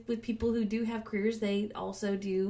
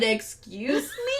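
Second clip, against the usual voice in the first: retold indignation; 3 dB louder, slower than usual. Retold quarrel, looking at husband.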